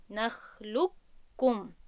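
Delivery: drawn-out «نخلقکم», only a doubled kaf where the qaf was; complete merging. Shown as a demonstration. («نخلقکم» آهسته با ادغام کامل)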